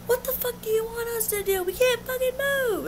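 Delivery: high, exasperated mimicked retort; retold angry protest. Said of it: "and they're like".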